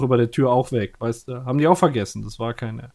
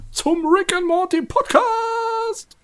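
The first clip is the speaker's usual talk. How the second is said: slow, higher announcer voice; announcer outro; 3 dB quieter, much slower than usual. Acted. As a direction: sign off like a radio host.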